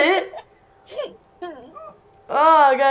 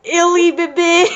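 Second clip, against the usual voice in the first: put-on high, laughing "Illy baby"; 6 semitones higher, 4 dB louder than usual. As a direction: giggle through the words. Laughing mock lover.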